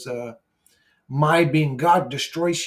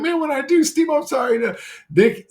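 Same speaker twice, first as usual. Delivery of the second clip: much higher, louder mock Australian accent; 12 semitones higher, 6 dB louder than usual. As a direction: ham up an Australian bloke apologising. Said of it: "Your accent was almost spot on".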